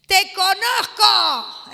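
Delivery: slow, drawn-out loud demon yell. Retold or imitated. Possessed man yelling at Jesus in the temple, slow and loud. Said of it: "un endemoniado le empezó a gritar".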